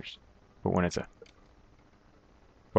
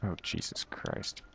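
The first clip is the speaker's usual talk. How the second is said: breathed out softly and slowly; exasperated whisper; 5 semitones lower, 14 dB quieter than usual. Real, not acted. Exasperated at his own mistake, a soft whisper. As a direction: whisper it in defeat.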